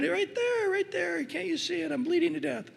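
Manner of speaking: put-on very high, whining kid voice; mock kid wailing about a scratch; melodramatic child. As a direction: overplay the tiny wound like a child.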